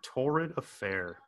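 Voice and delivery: slow, soft savored repeat; savoring. Pretend. Savoring the old-fashioned phrase.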